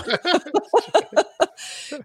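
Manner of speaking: a high, bursting laugh; real laugh. Real, not acted. Guest laughing openly at the host's admission. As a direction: let a real laugh break out and fade.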